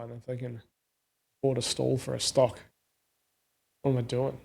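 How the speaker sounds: ordinary talk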